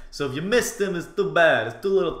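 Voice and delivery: put-on voice. Player telling viewers they missed the boss, put-on voice. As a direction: lilt it in a silly put-on voice. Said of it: "I don't know why I'm talking like this".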